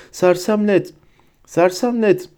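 high, repeated incantation; mild high-pitched shout; cried-out spell. A narrator's reading. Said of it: "diye haykırdı Neville".

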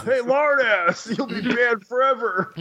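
high-pitched, drawn-out mock call; playful imitation of a yell; mock lifeguard yell.

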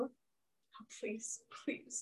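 very soft, whispered plea; hushed, pleading whisper; whispered begging. Pretend. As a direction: beg the dice under your breath. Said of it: "I'm begging you".

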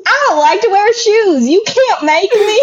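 put-on high, petulant kid voice refusing shoes; childish refusal. Barefoot rebel refusing the law, high petulant whine. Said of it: "someone was like".

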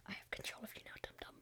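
whispered villain gloat; sinister whisper. Pretend. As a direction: breathe the threat. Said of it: "and I'll just like".

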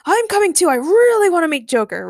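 high, loud, excited chiming in; eager. A narrator's reading. Ray begs to come along, excitedly. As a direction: jump in high and keen.

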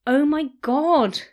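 slow loud dismay; aghast. A narrator's reading. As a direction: drag it out in horror. Panicked search for ring.

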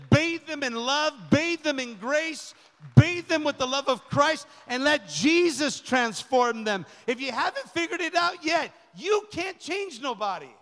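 loud, rising preacher's cry over the congregation; full-force preaching. Acted. Pastor calling the church to love newcomers, full preaching force.

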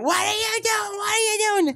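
high panicked mock scream, far above his voice; retold screaming. Driving examiner screaming at the student driver, high panicked shriek. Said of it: "she was screaming".

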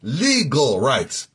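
"Legal rights" slowed right down and leaned on; very slow, weighty "Legal rights"; emphasis on legal rights. Shown as a demonstration. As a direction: hammer out "Legal rights" word by word. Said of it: "Very important I emphasize that word".

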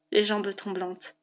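plain reading voice

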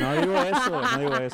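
high, laughing protest; laughing denial. Real, not acted. Denies with laughter.

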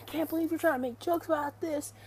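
high-pitched mock outrage; retold fury, voice pitched well up; imitated livid rant. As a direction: mimic someone going off in indignation. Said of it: "He was livid".

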